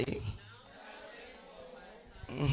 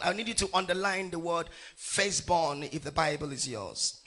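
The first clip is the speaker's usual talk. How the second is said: normal talking voice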